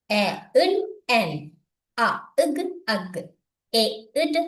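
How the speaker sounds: each sound apart, as in "அ, இக்", then joined as "அக்"; separated sounds like "ஓ, உட்", then "ஒட்"; phonics blending. Shown as a demonstration. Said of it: "இப்ப வாசிப்போம்".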